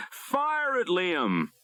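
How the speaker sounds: captain shouting to fire the dart; urgent shout. A narrator's reading. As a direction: yell the order to fire. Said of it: "Darling shouted".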